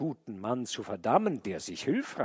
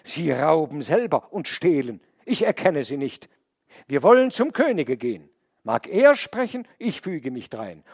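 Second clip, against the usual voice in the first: louder, higher appeal; defiant man; 5 semitones higher, 9 dB louder than usual. A narrator's reading. The man defies the wolf and bear, loud and raised. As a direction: stand firm and appeal to the king.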